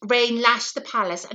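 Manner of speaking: plain reading voice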